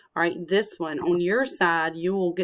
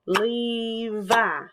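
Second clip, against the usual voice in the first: LE-VI in two drawn-out beats; syllable by syllable; 3 semitones higher than usual. Shown as a demonstration. Teacher clapping her little boy's name, "Levi" in two beats. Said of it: "I would clap his name and say".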